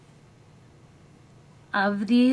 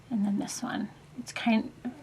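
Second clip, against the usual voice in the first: soft whispered aside; whispering; 6 dB quieter than usual. Real, not acted. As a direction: drop to a whisper while showing. Quiet aside while showing brushes.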